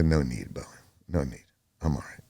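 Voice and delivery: low, soft retold reply; subdued. Prisoner answering guard about suicide watch, subdued.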